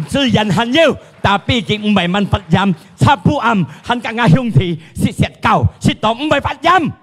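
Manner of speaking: high, loud preaching; impassioned preaching. Acted. Preacher exhorting the congregation about faith, impassioned.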